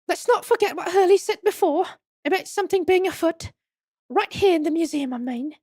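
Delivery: high-pitched companion voice; earnest companion. Acted. Companion reminds the hero of Hurley's words, high earnest voice.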